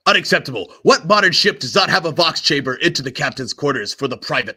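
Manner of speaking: loud, indignant bluster; booming officious indignation; outraged captain. Acted. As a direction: splutter in outrage at the excuse. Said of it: "He says".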